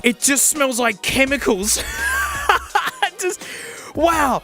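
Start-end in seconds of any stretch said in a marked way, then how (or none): 1.8-3.1 very high giggle; short squealing laugh. Real, not acted. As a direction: burst out laughing, pitched way up. Laughing at chemical smell.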